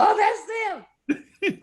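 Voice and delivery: high, slow, laughing "oh, that's them"; half-laughing as she spots the band; laughing recognition. Retold or imitated. Young singer spotting the band from her hotel window, laughing.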